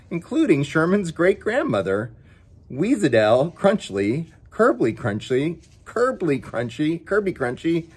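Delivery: ordinary delivery, nothing marked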